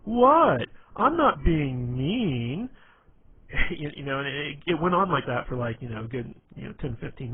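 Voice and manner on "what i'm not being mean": loud, high, slow mocking denial; sarcastic voice. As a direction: stretch it out like pure sarcasm. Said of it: "everything he said sounded really sarcastic".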